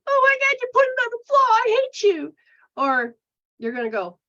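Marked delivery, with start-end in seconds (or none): 0.0-2.3 much higher, louder hammed-up shriek; mock outrage. Overreacting to someone who takes offense, hammed-up screaming fit. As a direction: blow up theatrically at the offender. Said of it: "you're not gonna go".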